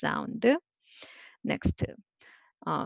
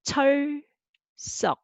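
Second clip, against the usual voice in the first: 철석 high and stretched, pause between syllables; 8 semitones higher, 4 dB louder than usual. Shown as a demonstration. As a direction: dictate 철석 syllable by syllable, pitched high. Dictating wave word for writing.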